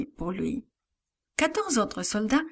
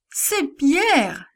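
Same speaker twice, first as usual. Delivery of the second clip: tearful; 6 semitones higher, 4 dB louder than usual. A narrator's reading. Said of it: "avec des larmes dans les voix".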